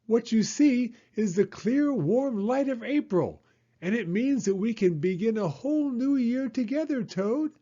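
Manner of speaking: high, gentle frog voice promising spring; cheerful frog. Acted. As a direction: coax a friend out into the light. Frog's voice.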